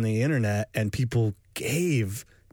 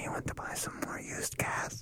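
whisper; 13 dB quieter than usual. Pretend. Thief whisper again.

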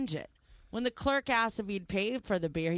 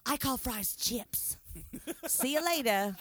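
mock English accent; 3 semitones higher than usual. English thief leaving.

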